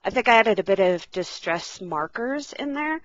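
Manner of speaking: out of breath; short of breath. Real, not acted. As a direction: speak as if winded. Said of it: "I'm getting short of breath here".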